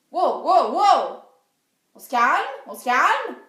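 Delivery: loud startled wow, then urging calm; alarmed yelp. Retold or imitated. Girl jabbed by a guard's spike, loud alarmed protest. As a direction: back off fast, loudly calming him.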